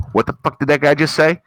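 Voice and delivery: loud, hammed-up indignation; mock outrage. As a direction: imitate online critics rising to a high shout. Mimics outraged replies online.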